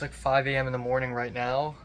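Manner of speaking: ordinary talk, nothing marked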